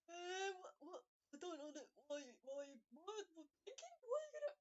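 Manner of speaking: high, loud, near-crying bewilderment; flustered whimper. Acted. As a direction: babble in wounded confusion. Mo flustered under blanket.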